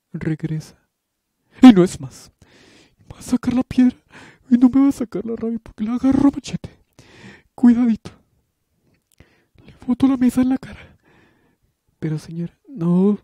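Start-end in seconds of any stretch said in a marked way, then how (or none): 0.1-11.0 very high, loud, fast furious threats; unhinged rant. Acted. Headmistress screaming wild threats at Candy, fast furious rant. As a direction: rage like she's lost her mind.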